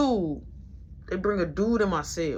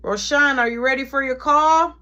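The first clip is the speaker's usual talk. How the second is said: loud, higher-pitched summons; guard calling out; 6 semitones higher, 7 dB louder than usual. Retold or imitated. Guard calling inmate to the phone, loud summons. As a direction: imitate a guard hollering for an inmate. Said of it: "one of the guards come like".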